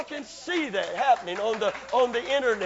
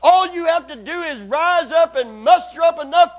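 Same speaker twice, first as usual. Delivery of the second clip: hammering sermon peak, voice soaring; full-force preaching; 8 semitones higher, 14 dB louder than usual. Acted. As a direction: raise the roof with praise.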